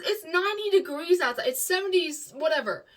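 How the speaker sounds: loud, high protest; exasperated objection. Retold or imitated. Imitating partner objecting to her wanting something hot, exasperated.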